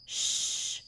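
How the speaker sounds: soft, breathy hush to calm; soothing shush. Acted. Queen soothing the frightened human, soft shush. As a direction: hush them gently like a frightened child.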